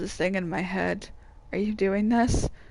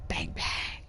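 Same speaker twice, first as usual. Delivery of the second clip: whisper; 9 dB quieter than usual. Acted. Whispered aside before going in.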